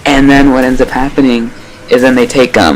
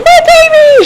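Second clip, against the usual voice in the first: very high wail of panic; imitating a frantic mother crying out; shrill mock cry for her baby; panicked mother; 3 dB louder, slower than usual. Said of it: "She's, like, freaking out".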